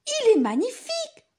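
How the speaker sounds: high, delighted exclamation; excited cry. Acted. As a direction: cry out with joy. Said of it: "s'écria Paul".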